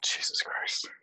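hushed, embarrassed whisper. Real, not acted. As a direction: whisper it, half ashamed. Embarrassed about weekend memory.

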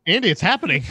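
loud, rising excited cry; mock amazement. Co-host pretending Andy is ascending to heaven, mock amazement. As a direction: play astonished witness to a miracle.

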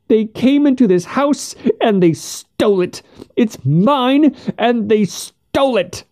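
put-on high, frantic old woman's voice; shrill with grief and outrage; distraught old woman. A narrator's reading. Distraught widow accusing thieves.

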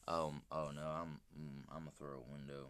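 low frustrated mumbling. Real, not acted. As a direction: grumble under your breath. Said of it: "you're mumbling again".

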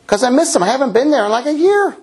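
high, guilty whine about missing church; hammed-up high guilty voice; mock churchgoer. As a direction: play the lapsed churchgoer. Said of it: "you're like".

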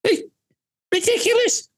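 very high-pitched indignant cry; exasperated. Real, not acted. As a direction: voice leaps right up in outrage. Indignant at the whole policy.

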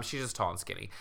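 normal talking voice

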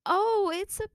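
very high, loud fake cheer; forced delight. Opening a pillow instead of skates, loud forced delight. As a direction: cover the letdown with cheer. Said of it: "I tried so hard to not be disappointed".

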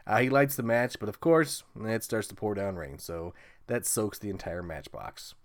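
ordinary talk

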